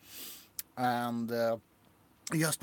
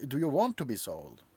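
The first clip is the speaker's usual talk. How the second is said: ordinary talk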